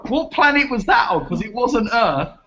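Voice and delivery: scornful mockery. Real, not acted. Teasing his co-host's claim, high mocking scorn. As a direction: ridicule him.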